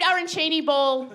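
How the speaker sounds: half-hearted replay of a scream; mild mock scream. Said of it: "So I screamed".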